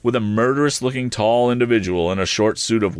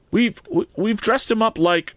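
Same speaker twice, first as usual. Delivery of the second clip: high, stammering nervous reply; a hint of nervous informant; 14 semitones higher than usual. A narrator's reading. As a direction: stumble over it, nervous. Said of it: "in a nervous manner".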